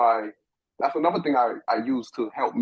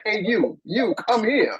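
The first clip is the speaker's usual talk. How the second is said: put-on girl calling out; 10 semitones higher, slower than usual. Girlfriend voiced calling him over.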